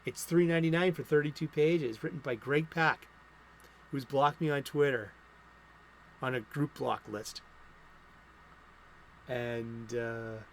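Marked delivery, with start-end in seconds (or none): none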